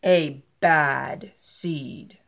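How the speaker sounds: low, very slow and ominous; spooky storyteller drop; ominous whisper-slow. A narrator's reading. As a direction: let it hang, dark and heavy.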